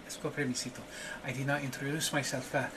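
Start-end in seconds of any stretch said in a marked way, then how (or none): none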